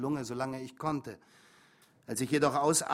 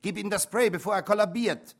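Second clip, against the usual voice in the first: louder and higher, heated scolding; furious mother; 6 semitones higher, 7 dB louder than usual. Acted. Mother berating the father to give the boy his spray, furious. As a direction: tear into him to make him hand it over.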